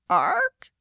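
high-pitched raven squawk; protesting raven. A narrator's reading. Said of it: "protested a raven".

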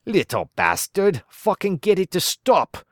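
put-on fed-up father snapping at baby; irritated Gaz. Gaz angry at crying baby.

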